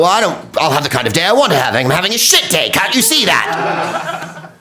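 huffy mock Englishman; indignant refusal of "nice day"; high, offended English retort; grumpy English reply. English person rebuffing nice day.

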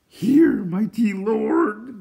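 very high, loud creature shout; shouting Gurgi. A narrator's reading. Creature announcing his find to his master, high loud shout. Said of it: "Gurgi shouted".

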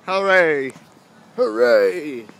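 loud, playful hooray; mock cheer. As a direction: cheer it out like a kid.

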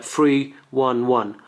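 slow, each digit separate; digits one at a time. Shown as a demonstration. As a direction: spell out three one one slowly. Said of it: "You're gonna say, like".

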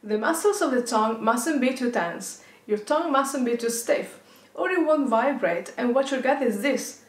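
ordinary talk

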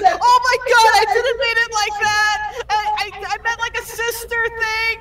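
very high-pitched, panicked squeal; retold freak-out. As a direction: act out a flustered, squealed protest. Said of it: "were just both freaking out".

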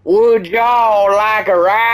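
put-on loud, high drawled accent, stretched out; exaggerated drawled accent. Player putting it in Michael's language, exaggerated drawled accent. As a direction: holler it slowly in a hammed-up drawled accent. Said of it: "in a way Michael will understand".

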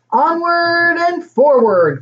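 high, drawn-out rallying call. A narrator's reading. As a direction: call it out slowly to rally the children. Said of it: "called out Granddad".

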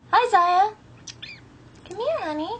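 high cooing pet voice. Real, not acted. Cooing to her cat.

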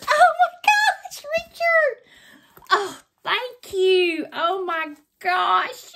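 high, loud, tearful squeal of joy; overwhelmed. Real, not acted. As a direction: cry out in thrilled disbelief. Discovers the gift is signed.

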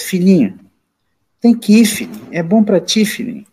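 mildly mournful persuasion, falling away; tearful coaxing, retold. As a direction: act out a tearful father figure coaxing. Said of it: "Aí eles chorando".

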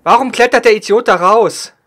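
loud angry yell, stress on der; man down shout. Real, not acted. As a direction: shout it at the teammate. Teammate climbs out, frustrated.

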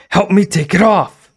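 high, loud insisting shout. Acted. Girl shouting at the silent store owner, insistent. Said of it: "insisted, shouting".

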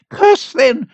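high-pitched challenge; woman's defiant cry. A narrator's reading. Old woman dares the priest, high defiant cry. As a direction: cry high, daring him to do it. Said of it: "cried the unfortunate woman".

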